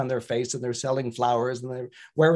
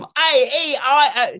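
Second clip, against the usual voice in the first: slow Cockney vowels; 10 semitones higher, 7 dB louder, slower than usual. Shown as a demonstration. Pupil improving vowels.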